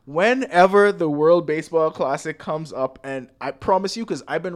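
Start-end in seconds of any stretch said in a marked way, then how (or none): none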